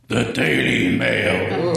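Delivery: slow echoing voice of doom; booming sepulchral voice. Acted. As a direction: boom it out like an oracle. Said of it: "booming se-, sepulchral voice".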